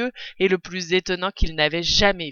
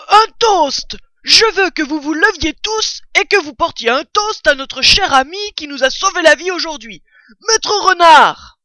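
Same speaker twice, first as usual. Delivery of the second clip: proclamation très haute et très forte; toast crié; 9 semitones higher, 9 dB louder than usual. A narrator's reading. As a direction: porte un toast à pleine voix. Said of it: "leva son verre de cidre et s'écria". (blaireau qui harangue les convives en criant)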